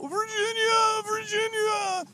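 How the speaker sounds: very high, slow wailing cry. Acted. Joshy crying out for Virginia, very high slow wail. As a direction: wail her name in despair. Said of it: "you hear him go".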